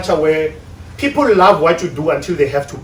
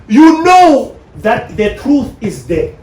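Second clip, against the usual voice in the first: slow, louder, every word weighted; slow emphatic; 5 semitones higher, 6 dB louder, much slower than usual. Real, not acted. Speaker pressing a hard truth on couples, slow and emphatic. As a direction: drive the point home slowly.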